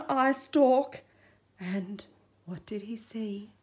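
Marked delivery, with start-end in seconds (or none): 1.6-3.5 suspenseful whisper. A narrator's reading. Suspense in storytelling.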